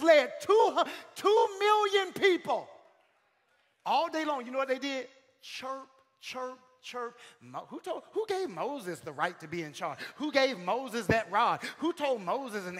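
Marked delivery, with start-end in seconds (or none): 5.4-7.2 soft cricket chirp noises; quiet mock cricket sounds; imitated cricket chirps. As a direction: chirp softly like crickets in a silent room. Mocking the silent crowd.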